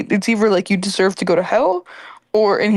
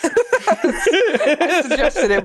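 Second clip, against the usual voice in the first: laughing; 7 semitones higher, much slower than usual. Real, not acted. Laughing at friend's attempt.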